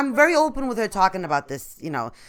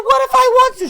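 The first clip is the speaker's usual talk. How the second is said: indignant; 13 semitones higher, 6 dB louder than usual. Retold or imitated. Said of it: "I said".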